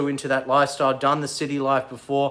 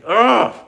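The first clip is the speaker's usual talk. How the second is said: loud, higher disgusted grunt; irritated ugh; 7 semitones higher, 7 dB louder than usual. Real, not acted. Annoyed at commenters, loud disgusted grunt. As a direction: let out an exasperated grunt.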